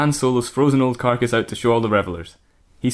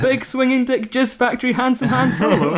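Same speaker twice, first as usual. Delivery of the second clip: high, laughing lewd rundown of the name; lusty Samantha; 11 semitones higher than usual. Acted. Samantha upgrading Han Solo's title, high and lusty. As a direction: pile on the filth, way up high. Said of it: "Samantha continued".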